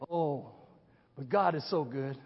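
ordinary delivery

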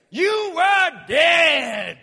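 slow, drawn-out preacher's shout; shouted slowly. Acted. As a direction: bellow it slowly to the congregation. Preacher's shouted climax line.

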